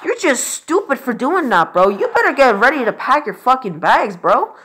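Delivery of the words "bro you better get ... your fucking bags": normal talking voice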